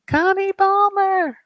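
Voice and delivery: high, loud, drawn-out name; high, slow hailing of a friend; sing-song call-out. Real, not acted. Calling out a viewer's name.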